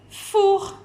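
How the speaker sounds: "four" alone, high and emphatic; teacher sounding out "four"; isolated syllable. Shown as a demonstration. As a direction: present "four" as one separate, high-pitched syllable. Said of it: "par la syllabe".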